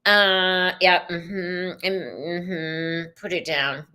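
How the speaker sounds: usual speaking voice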